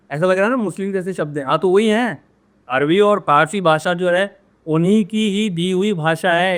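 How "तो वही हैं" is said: ordinary talk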